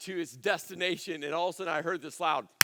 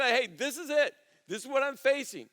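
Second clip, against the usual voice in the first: high, loud declaration; saying it out loud; 6 semitones higher, 4 dB louder than usual. Acted. Preacher shows hesitant listeners how to name it, out loud. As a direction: claim it boldly, voice lifted. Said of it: "actually saying it out loud".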